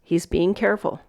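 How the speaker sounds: ordinary talk, nothing marked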